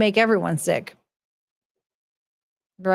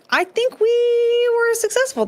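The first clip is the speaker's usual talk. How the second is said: squeaky high voice. Acted. Mock triumphant closing remark.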